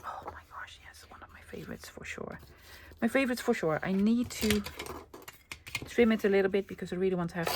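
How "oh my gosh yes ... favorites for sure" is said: breathy whispered delight; hushed gush over a favorite piece; excited whisper. Real, not acted. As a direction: whisper it like a treasured secret.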